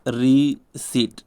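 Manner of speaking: रिसीट said very slowly, silent p; slow drawn-out रिसीट. Shown as a demonstration. Teacher slowly modelling रिसीट for students. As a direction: stretch out रिसीट with no p sound. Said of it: "रिसिप्ट नहीं पढ़ते".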